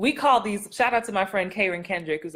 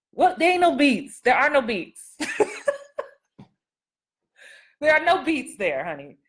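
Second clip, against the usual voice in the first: playful insistence; 6 semitones higher than usual. Real, not acted. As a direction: laugh and insist there's nothing to find. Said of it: "teasing correction".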